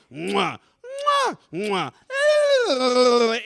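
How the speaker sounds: repeated exaggerated smooches; kissing noises. Daughter and Daddy kissing in a skit, exaggerated smooches. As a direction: smack out big stage kisses.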